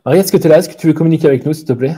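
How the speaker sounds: loud, solemn call to a spirit; séance medium. Acted. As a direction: summon the ghost, raising the voice. Calls spirit Marie loudly.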